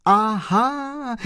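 high, joyful exclamation; joyful cry. Acted. The postman cries out to the chauffeur in high delight. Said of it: "vykřikl pan Kolbaba radostně".

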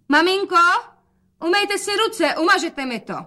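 very high, sing-out voice; calling out. A narrator's reading. Daughter telling mother to wash hands, calling high. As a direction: call it out high. Daughter calls aloud before whispering.

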